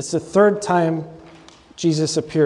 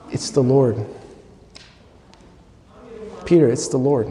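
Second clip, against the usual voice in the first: gentle, charitable; 5 semitones lower than usual. Retold or imitated. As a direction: gently break the news to a friend. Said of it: "very gently, very charitably".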